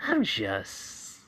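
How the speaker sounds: slow, quiet menacing line with a cackle; sinister taunt. Acted. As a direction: gloat darkly. Izuku taunting with a laugh.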